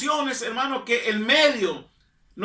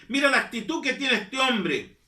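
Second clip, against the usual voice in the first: ordinary talk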